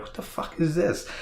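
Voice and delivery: jumps way up high in disbelief; a sudden, baffled yelp of confusion; mock shock at a strange sound; startled outburst. Shocked quote of own reaction.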